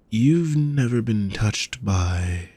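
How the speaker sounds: drawn out, trailing off; slow disbelief. Acted. Hostess slowly questioning an inexperienced guest, drawn out. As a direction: let the question hang slowly.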